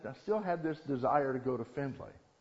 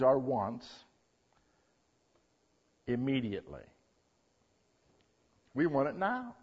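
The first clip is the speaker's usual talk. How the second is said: ordinary talk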